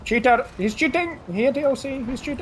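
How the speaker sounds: put-on high, excited Indian accent voice. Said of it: "Is that your Indian voice?".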